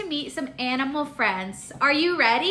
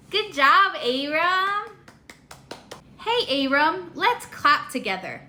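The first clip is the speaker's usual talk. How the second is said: ordinary talk, nothing marked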